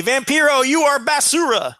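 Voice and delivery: high, declamatory wrestler's taunt; mock calling out. Wrestler pointing over the ropes at his rival, mock taunting. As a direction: call out a retold insult.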